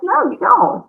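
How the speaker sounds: high, emphatic mock reply; amused agreement. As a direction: toss it back in a high voice. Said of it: "I'm like".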